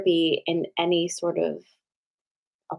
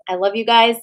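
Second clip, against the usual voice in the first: affectionate goodbye; 5 semitones higher, 6 dB louder than usual. Real, not acted. Signing off to viewers.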